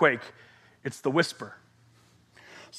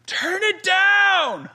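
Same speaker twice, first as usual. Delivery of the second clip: high, drawn-out yell; shouting parent; 13 semitones higher, much slower than usual. Acted. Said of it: "they could shout at you".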